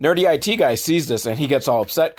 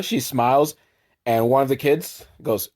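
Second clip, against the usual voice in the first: ordinary talk, nothing marked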